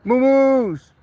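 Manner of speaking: high, loud call across the field. Real, not acted. Farmer calls his cows across the pasture, high and loud. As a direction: sing out to the herd.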